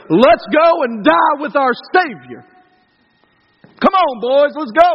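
rally the troops to charge into death; Braveheart rallying cry. Retold or imitated. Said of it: "One is the Braveheart way".

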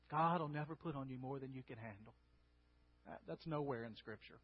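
ordinary talk

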